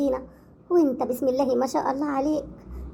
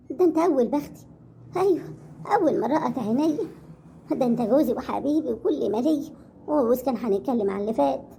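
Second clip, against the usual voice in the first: usual speaking voice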